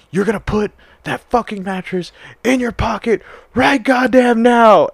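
high, hammed-up shouted command, slowing at the end; shouting drill instructor. Instructor ordering a recruit while cleaning, mock shouting.

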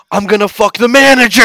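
loud, high hollered vow; rowdy boast. Acted. Frat guy vows to outdo himself, hollering. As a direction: holler it to rile up the guys.

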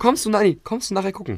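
high, pointed "Kommst du nachher gucken"; provocative emphasis. Retold or imitated. Friend mimics his disputed invitation, provocative emphasis. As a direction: push "Kommst du" up high and loud, then ease off. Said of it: "wie er es schon betont hat".

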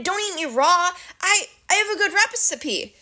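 high, stammering plea; pleading. A narrator's reading. Grover bargaining with the monster, high pleading. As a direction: beg up high, scrambling for an idea. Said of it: "Grover pleaded".

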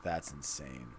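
low, very soft amazement; stunned hush. Real, not acted. Co-host reacting to the wild idea, hushed amazement. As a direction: marvel under your voice.

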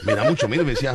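ordinary talk

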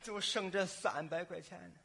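low, soft deathbed voice; hushed, low acting of last words; dying grandfather. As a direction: speak feebly as an old man on his deathbed. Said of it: "弥留之际躺在床上".

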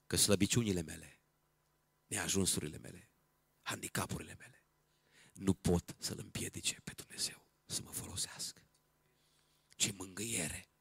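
soft confiding sermon voice, fading towards a whisper; quiet, reverent reflection; hushed preaching. Real, not acted. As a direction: let it sink in quietly, with pauses.

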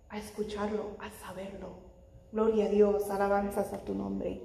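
ordinary delivery

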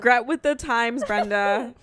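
teasing. Real, not acted. Teasing friend about Minder.

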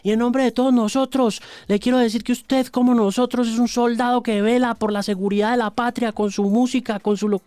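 formal soldier greeting. Retold or imitated. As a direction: address the host like a soldier reporting.

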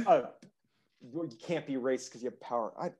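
ordinary delivery

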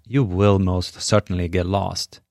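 ordinary talk, nothing marked

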